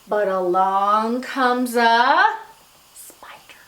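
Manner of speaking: drawn-out build, dropping to a whisper; suspenseful build to whisper. Shown as a demonstration. Teacher hints at what scares Miss Muffet, suspenseful. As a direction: stretch it slowly, then whisper the last word.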